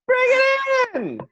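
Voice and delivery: high, drawn-out mock wail; hammed-up high-pitched delight; gushing greeting. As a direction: gush loudly as if calling someone into a hug. Joking gushing hug for old friend.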